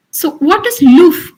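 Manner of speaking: normal talking voice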